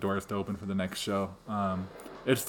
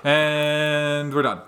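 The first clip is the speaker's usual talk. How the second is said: slow, louder triumphant finish; relieved finish; 5 semitones higher, 5 dB louder, much slower than usual. Real, not acted. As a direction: land it with relief. Relieved end of rushed list.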